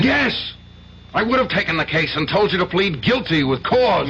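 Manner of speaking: yelped high Yes, then a heated rant; angry outburst. Acted. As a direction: snap back in high outrage. Lawyer furious at client.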